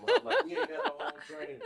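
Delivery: high, fast laughing scold; laughing tease. Real, not acted. Guest teases host about manners while laughing. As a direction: scold her through laughter, mock-outraged.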